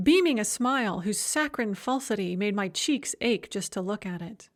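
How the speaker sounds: ordinary delivery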